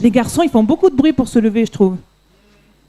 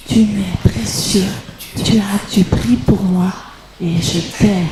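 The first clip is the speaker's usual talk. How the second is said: whispering; 3 semitones lower than usual. Shown as a demonstration. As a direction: breathe the verse in a whisper. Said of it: "Et on va chuchoter".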